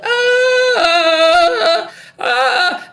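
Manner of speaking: loud, high wailing "aah"; screamed song. Acted. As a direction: belt out a long high note, then again. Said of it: "they're suddenly screaming it and yelling it".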